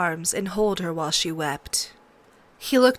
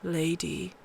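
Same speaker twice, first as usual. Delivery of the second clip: much softer, lingering over the name; Ned softly naming the doomed pup; tasting the name; 2 semitones lower, 8 dB quieter than usual. A narrator's reading. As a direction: let the name rest on the tongue. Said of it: "tasting the name".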